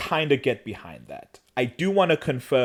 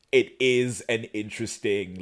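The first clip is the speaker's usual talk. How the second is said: ordinary talk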